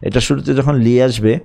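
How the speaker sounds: ordinary talk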